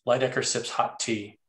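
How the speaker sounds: normal talking voice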